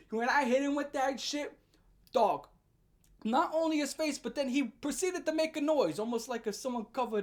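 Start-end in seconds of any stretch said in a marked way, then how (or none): none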